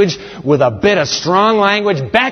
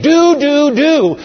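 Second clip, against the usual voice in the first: tempting voice; 10 semitones higher, 4 dB louder, slower than usual. Acted. Tempting inner voice.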